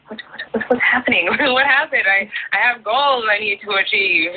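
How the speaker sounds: high, rushed, half-laughing mock panic, the first whats breathed; a whispered then squeaky what, what, what, hammed-up panic. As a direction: play your past self, lost and panicking, half as a joke. Panicked return from trip.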